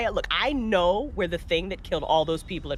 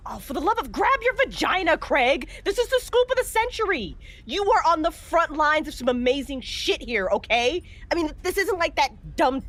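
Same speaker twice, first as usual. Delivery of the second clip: higher and rising, nearly squealing with excitement; excited outburst; 7 semitones higher than usual. Acted. Eden urging Craig not to leave the hospital, excited and high. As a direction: she can't contain her thrill at the scoop. Host thrilled by morgue scoop.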